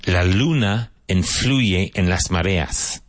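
"La luna influye en las mareas" said slowly, word by word, for learners; slow model reading. Shown as a demonstration. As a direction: spell out the Spanish sentence slowly like a teacher. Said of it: "Vaughan Translation Booklet seven".

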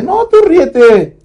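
a mom insisting he just laugh it off; mother's voice. Retold or imitated. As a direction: son being bullied, mother urges him in a high, slow voice. Mother advising son.

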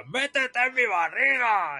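high, slow hammed-up villain; imagined villain voice. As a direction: ham it up like Fat Bastard. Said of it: "me lo imaginaba que decía". (voz de villano exagerada, aguda y lenta)

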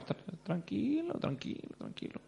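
trailing off into a soft hush; lightly acted soothing, fading to near whisper; mock calming. Papá calma al tipo. (el papá calma al tipo, cada vez más bajito)

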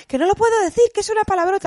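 put-on squeaky kid protesting she can't say it; little girl refusing to say the bad word; imitating her daughter. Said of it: "Y empezaba".

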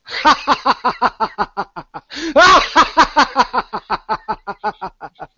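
loud high villain cackle; evil laugh. Acted. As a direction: cackle like a triumphant dark god. Villain ends his threat.

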